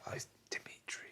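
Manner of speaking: breathy unvoiced whisper; hushed aside. Real, not acted. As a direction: drop to a secretive whisper. Whispered aside about husband.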